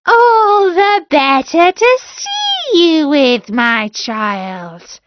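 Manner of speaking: the wolf straining to sound like grandma; hammed-up high granny voice, slow; wolf as grandmother.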